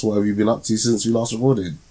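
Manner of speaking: normal talking voice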